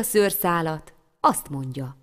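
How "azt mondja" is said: plain reading voice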